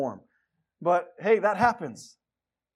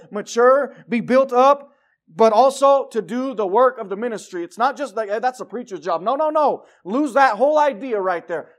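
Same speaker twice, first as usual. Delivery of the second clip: full-voiced sermon preaching; 7 dB louder than usual. Real, not acted. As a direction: preach it to the congregation. Preaching in church.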